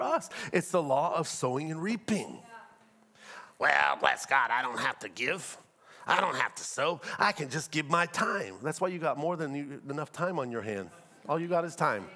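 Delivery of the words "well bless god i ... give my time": low mocking churchgoer voice; put-on lower excuse-making voice. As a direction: imitate a believer making pious excuses. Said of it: "I heard Christians say that".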